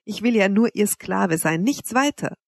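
ordinary delivery

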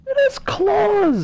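high startled yelp; startled shriek. Real, not acted. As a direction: shriek at the claws. Shocked by alien slime.

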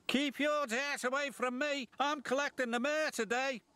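high, proud engine voice, fussy about dirt; haughty James the engine. A narrator's reading. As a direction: speak up high, looking down on him.

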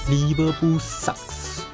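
slow mocking jeer; drawn-out taunt. Real, not acted. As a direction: sneer the rival team's name. Teasing rival club.

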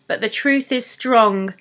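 usual speaking voice

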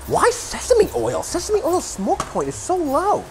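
incredulous. Real, not acted. Reading recipe, baffled, high pitch.

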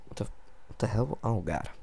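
ordinary talk, nothing marked

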